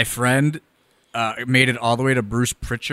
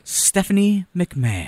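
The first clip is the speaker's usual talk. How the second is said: higher, slow recorded-greeting voice; voicemail greeting; 6 semitones higher, much slower than usual. Retold or imitated. Imitating Stephanie McMahon's voicemail, a slow high greeting. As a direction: say the name like a polished voicemail. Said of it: "And she would go".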